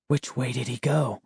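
soft whispered question. Acted. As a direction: whisper it so no one hears. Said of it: "I whispered".

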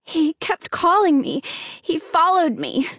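breathless, spooked report. A narrator's reading. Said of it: "Hannah said breathlessly".

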